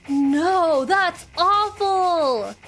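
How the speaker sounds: loud, high, slow outraged cry; horrified. Real, not acted. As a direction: exclaim in shock. Horrified reaction to game.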